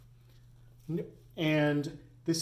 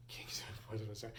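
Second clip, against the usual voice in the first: whisper; 5 semitones lower, 14 dB quieter, faster than usual. Real, not acted. Teacher forgets slogan mid-example.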